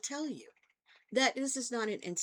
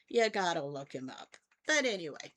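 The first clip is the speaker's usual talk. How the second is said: ordinary talk, nothing marked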